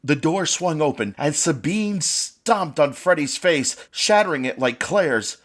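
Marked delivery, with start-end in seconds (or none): none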